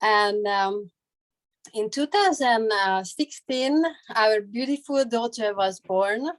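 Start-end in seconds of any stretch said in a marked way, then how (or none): none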